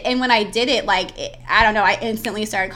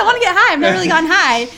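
much higher and louder, mock over-eager; eager excitement; 8 semitones higher, 9 dB louder, faster than usual. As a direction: bounce with excitement to finally try it. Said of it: "just like that".

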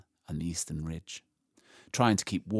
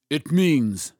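lion voice; 7 semitones higher, 8 dB louder than usual. Acted. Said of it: "said Aslan".

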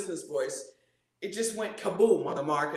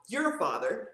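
ordinary talk

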